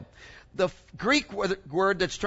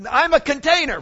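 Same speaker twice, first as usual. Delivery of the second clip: higher and louder, bursting with joy; excited; 6 semitones higher, 6 dB louder than usual. Real, not acted. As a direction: proclaim it like good news. Joyful climax of the sermon.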